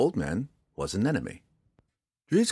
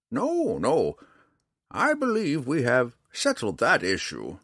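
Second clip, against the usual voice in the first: put-on old man, amiably insistent; 7 semitones higher, 4 dB louder than usual. Old man offers the drow shelter, high and deliberate. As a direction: play a foreign-tongued old man, pitched up and measured.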